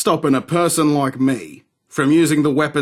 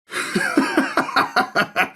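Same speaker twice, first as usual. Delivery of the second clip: amused laugh; 10 semitones higher than usual. Acted. Laughs at a bold offer.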